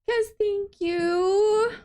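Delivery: high, slow, touched thank you; gushing thanks. Real, not acted. Streamer thanking Kaz for a cinnamon roll, gushing. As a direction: stretch out the name, overwhelmed.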